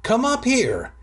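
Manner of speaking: high, mock call from another room; hammed-up yell up the stairs; little brother calling from his bedroom, high mock shout; mock shout from upstairs. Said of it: "he shouted from his bedroom".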